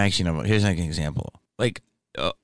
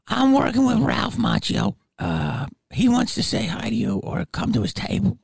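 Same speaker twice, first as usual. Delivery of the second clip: ghoul lady voice. Retold or imitated. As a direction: play the ghoul lady way up high and sugary.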